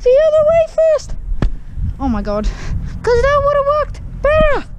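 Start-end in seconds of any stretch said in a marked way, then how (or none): 0.0-1.1 high, far, whiny know-it-all; mocking commenter voice. Vlogger imitates commenters correcting him, whiny mocking falsetto. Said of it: "People in the corner's gonna be". 2.4-4.7 high, far, whiny know-it-all; mocking commenter voice. Vlogger imitates commenters correcting him, whiny mocking falsetto.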